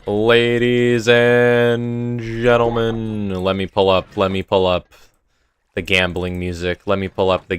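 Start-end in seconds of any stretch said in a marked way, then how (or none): 0.1-3.4 higher, very slow, drawn-out announcer call; showman announcement. Pretend. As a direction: stretch it out like a show host. Announcing the gambling round.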